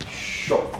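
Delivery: SHO opening on a long, hushed sh; soft sho. Shown as a demonstration. Teacher repeats the soft S with circumflex, hushed SHO. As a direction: ease gently into SHO with a long sh.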